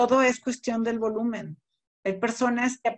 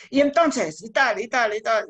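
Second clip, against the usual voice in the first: scolding tone; 2 semitones higher, 6 dB louder than usual. Shown as a demonstration. Teacher shows a course sounding like a scolding. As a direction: sound like you're scolding the class. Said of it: "parece que estoy regañando".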